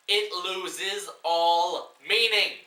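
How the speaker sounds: high, loud, drawn-out rant; slow outraged shout; slow furious emphasis. Real, not acted. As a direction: spell out each word in exasperation. Ranting at the film.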